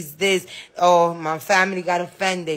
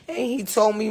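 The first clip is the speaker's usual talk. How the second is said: ordinary talk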